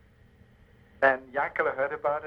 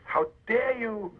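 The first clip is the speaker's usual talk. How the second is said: stern rabbi scolding a thief in the synagogue, angry; angry rebuke; 5 semitones higher than usual. Acted. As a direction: throw the thief out in a burst of anger. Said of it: "He got up in anger".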